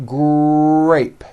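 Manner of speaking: "grape" with a long held R after the G; strong R blend. Shown as a demonstration. Teacher gives example of a blend, "grape" with dominant long R. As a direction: stretch the R in "grape" before the vowel. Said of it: "the R sound is the strongest".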